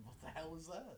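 usual speaking voice

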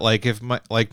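ordinary talk

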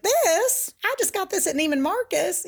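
high, giddy society lady voice; gushing red carpet chatter. Retold or imitated. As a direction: gush about the dress in a high voice. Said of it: "they're like".

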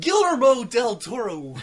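loud, high, slow exaggerated Spanish; fake Spanish accent. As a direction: roll out the name like a hammy announcer. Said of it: "That's not Spanish enough".

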